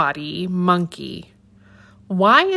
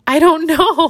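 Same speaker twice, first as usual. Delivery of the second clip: high, giggling monkey answer; laughing; 10 semitones higher, 6 dB louder than usual. Acted. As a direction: laugh through the words. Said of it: "laughs Baba".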